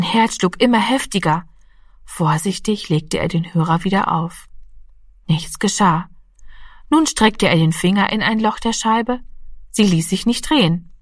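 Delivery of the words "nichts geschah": ordinary delivery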